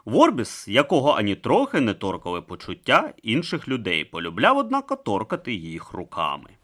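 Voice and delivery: ordinary delivery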